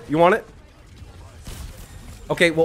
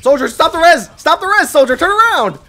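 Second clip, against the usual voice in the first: high, loud urgent shouting; frantic yell; 13 semitones higher, 8 dB louder than usual. Real, not acted. Gamer yelling at Soldier to stop the res. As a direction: bark orders across the fight.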